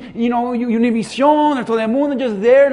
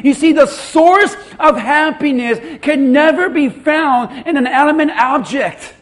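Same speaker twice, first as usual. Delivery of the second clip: full-force preaching; 4 semitones higher, 8 dB louder than usual. Real, not acted. Preacher listing false hopes to congregation, full-force preaching. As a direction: build to a loud, high-pitched cry.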